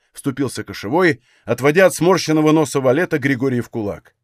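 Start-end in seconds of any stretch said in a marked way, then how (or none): none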